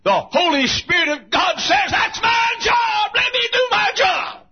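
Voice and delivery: high, loud revival shout; full-force preaching. Acted. Preacher voicing the Holy Spirit's claim on His job, full-force shout. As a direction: preach it at the top of your voice, drawing out the last words.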